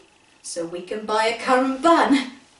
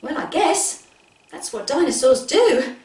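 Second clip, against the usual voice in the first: usual speaking voice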